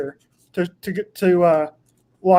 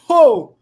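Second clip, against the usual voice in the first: pained gasp; 6 semitones higher, 7 dB louder than usual. Real, not acted. Reacting to pepper heat.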